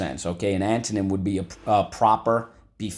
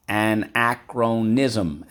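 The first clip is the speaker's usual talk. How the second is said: slow syllable drill; 2 semitones higher, 3 dB louder, much slower than usual. Shown as a demonstration. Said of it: "So let's go".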